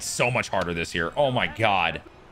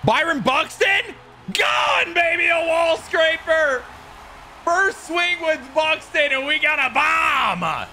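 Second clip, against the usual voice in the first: screaming, much higher and louder, slowing at the end; home run call; 14 semitones higher, 3 dB louder, slower than usual. Real, not acted. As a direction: belt it out like a play-by-play homer call. Calling his own home run.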